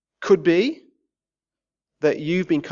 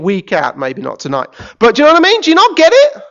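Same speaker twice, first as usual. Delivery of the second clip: normal talking voice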